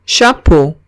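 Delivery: chapeau said the French way, sha-POH. Shown as a demonstration. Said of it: "Siguiente palabra".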